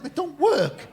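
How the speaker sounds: high, loud questioning echo; mock incredulous echo. Comedian questions the vasectomy patient, mock incredulous. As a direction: throw it back in acted disbelief. Comedian echoes complaint in disbelief.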